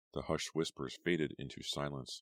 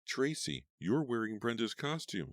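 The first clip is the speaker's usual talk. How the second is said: yell; 8 semitones higher than usual. A narrator's reading. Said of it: "someone yelled".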